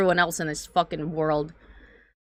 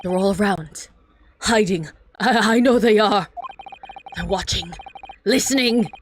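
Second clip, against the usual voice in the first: paranoid prisoner voice. Acted. Reads paranoid prisoner lines.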